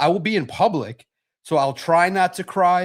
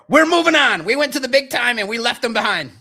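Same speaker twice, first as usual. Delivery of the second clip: loud, much higher mocking voice; mock Booner brag; 8 semitones higher, 5 dB louder than usual. Host mocking Booner's big-time brag, loud and high-pitched. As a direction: ham up the friend's smug brag. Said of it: "that was code for".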